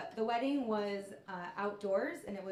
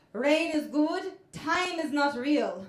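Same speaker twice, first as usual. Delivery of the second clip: much louder, slow, higher proclamation; old man on acid declaring solemnly; tripping Hungarian father; 5 semitones higher, 12 dB louder, slower than usual. Retold or imitated. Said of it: "and said".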